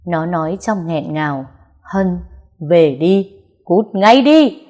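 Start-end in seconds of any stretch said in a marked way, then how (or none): 1.8-4.7 rising to a loud, slow shout; shouting at him. Acted. Heroine yelling at her ex to get out, furious shout. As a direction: drive him out with a furious yell. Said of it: "nó quát".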